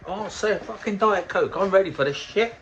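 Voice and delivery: high, cocky mock answer; cocky ready reply. Imitating himself answering the barman, cocky. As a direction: pitch it up, smug and ready. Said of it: "his ready answer at bar".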